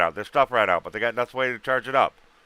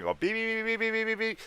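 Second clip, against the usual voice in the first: imitated beeping; 11 semitones higher, 7 dB quieter than usual.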